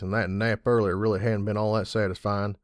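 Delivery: ordinary delivery, nothing marked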